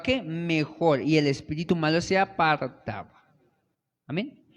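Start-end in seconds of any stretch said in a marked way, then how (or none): none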